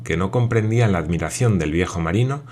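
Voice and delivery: usual speaking voice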